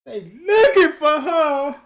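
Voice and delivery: loud, high mock-tearful wail; about to break down. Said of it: "like she about to break down".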